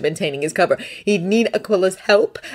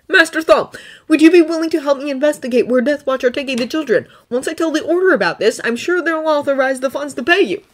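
put-on higher, earnest young Jedi voice; Thonne's voice; 9 semitones higher than usual. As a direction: lift the voice up for Thonne, sincere and pleading. Thonne asks for help.

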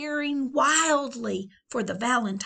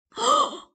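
high startled gasp; sharp gasp; 7 dB louder than usual. Acted. Leon shocked by the question, gasping.

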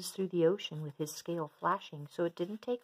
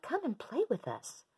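calling fish friends; 8 semitones higher than usual. Acted. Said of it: "they called".

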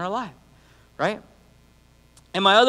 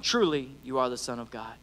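hushed worship; 7 semitones lower, 8 dB quieter than usual. A narrator's reading. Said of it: "worshiped him, saying".

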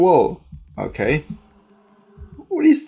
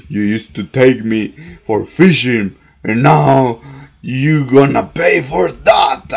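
mock threat; 6 semitones lower, 6 dB louder, slower than usual. Voicing worm threatening fisherman.

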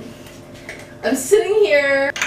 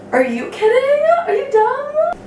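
excited. Real, not acted. Raving about leggings.

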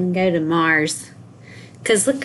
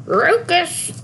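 put-on higher voice, slurred word; one last nag in an odd high voice; nagging people; 7 semitones higher than usual. People always telling Sam to focus, odd-voiced nagging. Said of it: "people always saying".

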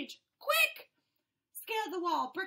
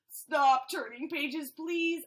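louder, slow begging; loud plea; 7 dB louder, slower than usual. Acted. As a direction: beg the reader, loud and slow. Fish begs the reader.

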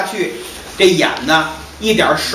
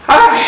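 a high, loud wail; wailing cry; 6 dB louder than usual. Acted. Master breaks down over his lost wife, wailing. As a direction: let out a sudden grief-stricken wail.

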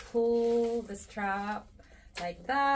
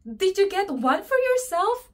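surprised question; 9 semitones higher, 8 dB louder than usual. Retold or imitated. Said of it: "And I said".

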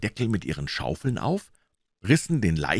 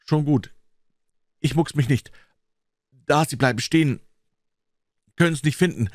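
put-on tense, higher boy voice; a frightened boy hiding, pitched up; scared boy; 8 semitones higher, 4 dB louder than usual. A narrator's reading. Boy's frightened whispered talk in graveyard.

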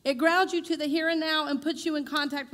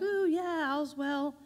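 higher, airy mocking of a blissed-out teacher; mock spiritual guru; 5 semitones higher, slower than usual. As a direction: send up a dreamy guru, lightly. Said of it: "spiritual teachings will have you like".

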